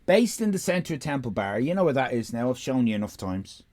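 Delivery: usual speaking voice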